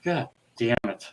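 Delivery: low-volume retold swearing; muttered curse. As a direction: mutter it softly, as if to yourself. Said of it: "he goes just like this".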